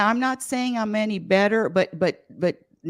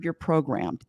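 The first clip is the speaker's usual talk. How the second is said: ordinary talk, nothing marked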